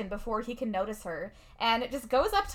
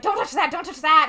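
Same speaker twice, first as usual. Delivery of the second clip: higher, louder panicked warning; freaking out; 5 semitones higher, 8 dB louder than usual. Retold or imitated. Will panics at guests wrecking his house, loud panicky warning. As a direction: snap at party guests in a panic. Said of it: "Will is freaking out".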